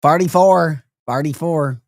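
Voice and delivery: "Farty four" with the local "ar"; St. Louis accent. Shown as a demonstration. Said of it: "We do have accents here".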